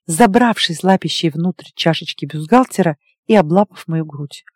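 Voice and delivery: ordinary delivery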